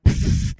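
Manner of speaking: a breathy pff of contempt; an unvoiced puff of scorn; dismissive scoff. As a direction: scoff like you know better. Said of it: "I'm like".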